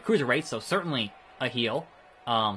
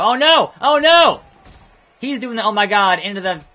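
much higher, louder panicked cries; panicked screaming; 7 semitones higher, 11 dB louder than usual. Real, not acted. Panic at opponent's move.

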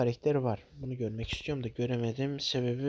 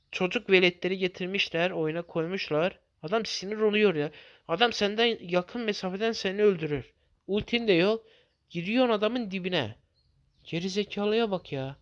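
exasperated rant; 5 semitones higher than usual. Real, not acted. Ranting at teammate.

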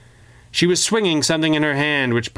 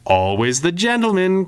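sneering villain; 7 semitones higher than usual. A narrator's reading. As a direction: sneer the compliment at the old rival. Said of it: "Chaos sneered".

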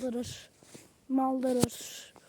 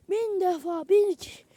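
rising, louder exaggeration; excited boast; 5 semitones higher, 5 dB louder than usual. Real, not acted. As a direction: climb higher and louder on "bin iki". Exaggerating number of saves.

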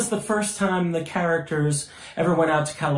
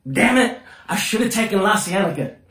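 imitating upset driver; 5 semitones higher than usual. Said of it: "he keeps on saying".

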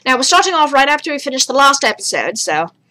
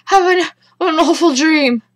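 high, loud, gasping protest; scared refusal; 6 semitones higher, 4 dB louder than usual. Real, not acted. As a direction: refuse in fright, with a gasp. Scared by horror game.

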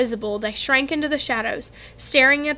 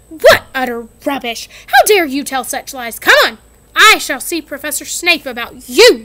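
louder angry scolding; furious teacher; 8 dB louder than usual. Acted. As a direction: crush the excuse. McGonagall furious at lies.

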